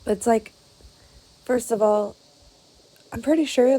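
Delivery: forcing the voice back after an apology; pushing through hoarseness. Real, not acted. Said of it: "I'm sorry".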